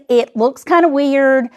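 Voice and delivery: ordinary talk, nothing marked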